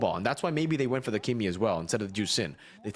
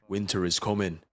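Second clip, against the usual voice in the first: a grave, lowered Jon Snow imitation; Jon Snow accent; 5 semitones lower than usual. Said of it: "with the accent".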